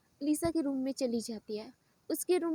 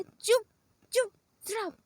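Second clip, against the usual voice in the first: higher, louder furious snap to silence her; angry shout; 7 semitones higher, 5 dB louder than usual. Acted. Lisa fed up with the child's drama, yelling at her. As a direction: bark the order to shut up. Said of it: "गुस्से से देख के".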